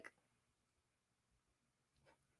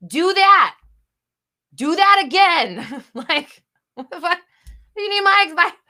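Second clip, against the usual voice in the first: high, loud, incredulous big-sister rant; higher and louder in exasperated disbelief; exasperated yelling; 9 semitones higher, 10 dB louder than usual. Real, not acted. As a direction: yell it like she can't believe it. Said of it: "big sister wants to yell at you".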